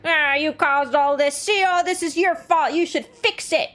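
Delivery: put-on hostile old lady. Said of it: "she's just like".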